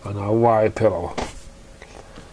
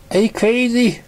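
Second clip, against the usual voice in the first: surprised; 10 semitones higher than usual. Acted. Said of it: "said surprised".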